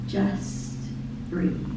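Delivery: softly breathed out; hushed. Acted. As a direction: hush it like a gentle calling. Soft refrain in lyric.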